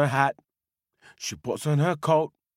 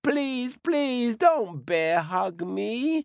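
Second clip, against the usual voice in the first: put-on high, pleading old woman; pleading old lady; 4 semitones higher than usual. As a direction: beg the bear not to squeeze her. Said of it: "said the old woman".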